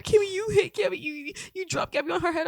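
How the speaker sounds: put-on mother discovering the dropped baby; a hint of a shocked mother; shocked mom. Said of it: "she was like".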